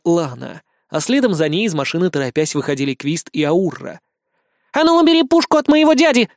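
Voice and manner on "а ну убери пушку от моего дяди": put-on high, loud girl's shout. A narrator's reading. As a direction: yell it at the cop. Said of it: "крикнула маленькая дьяволица".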